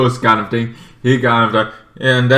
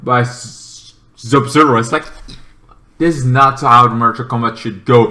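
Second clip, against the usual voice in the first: usual speaking voice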